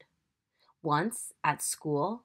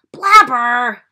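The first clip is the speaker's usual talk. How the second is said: very loud, higher howled name; 6 semitones higher, 19 dB louder than usual. Acted. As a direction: yell it across the cinema. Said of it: "howled Joan and George".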